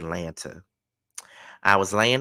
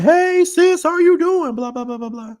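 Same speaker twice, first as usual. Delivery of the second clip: much higher and louder, bright and friendly; cheerful high greeting; 9 dB louder than usual. Retold or imitated. Dad greets his sister at the table, cheerful and much higher. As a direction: greet your sister with big warm cheer. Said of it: "He said".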